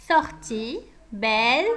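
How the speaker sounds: usual speaking voice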